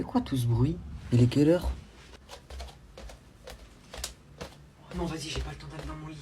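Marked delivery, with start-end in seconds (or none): 3.5-6.2 sleepy whisper. Acted. Sneaking back to bed, caught.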